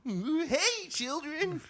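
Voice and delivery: put-on very high, cheery old-timer; jovial old guy. Shown as a demonstration. Old man greeting children, high jovial voice. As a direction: greet them brightly as a comic grandpa. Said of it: "mine's a little more jovial".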